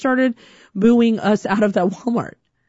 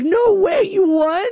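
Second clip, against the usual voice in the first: mock disbelief; 11 semitones higher, slower than usual. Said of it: "And she's like".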